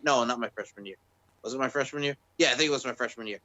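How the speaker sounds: normal talking voice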